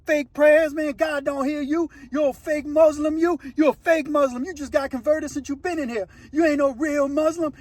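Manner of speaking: high-pitched acted jeering; mock taunting. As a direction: needle him like a cellblock bully. Acts out inmate taunting a praying rival.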